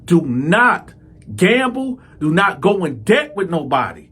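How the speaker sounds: exasperated scolding. Retold or imitated. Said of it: "I said".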